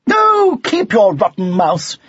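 high, drawn-out sniffy dismissal. A narrator's reading. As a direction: start high and loud, then sniff it away. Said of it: "said Dulcie Maud sniffily".

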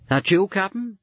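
high call into the storm. Acted. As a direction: call out through the wind. Frozen man calling into storm.

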